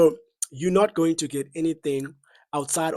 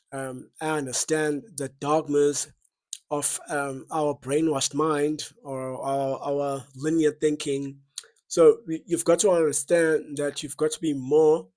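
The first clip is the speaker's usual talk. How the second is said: ordinary talk, nothing marked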